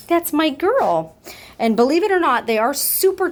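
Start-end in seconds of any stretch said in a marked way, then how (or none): 0.1-1.2 slow, high sing-song praise to a kitten; cooing pet talk. Real, not acted. Owner praising her kitten in slow cooing baby talk.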